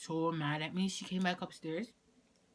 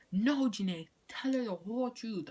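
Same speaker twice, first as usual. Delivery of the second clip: higher, louder insistent sister; imitating tattling sister; 6 semitones higher, 5 dB louder than usual. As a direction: butt in like a demanding sister. Sister demanding truth, retold.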